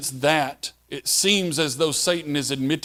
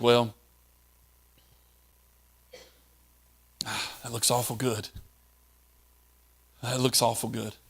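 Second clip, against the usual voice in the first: much softer, low, savoring murmur; tempted musing; 4 semitones lower, 6 dB quieter than usual. Retold or imitated. Preacher voicing a tempted listener eyeing the bait, soft and low. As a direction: linger over the bait as if tempted. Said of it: "you think".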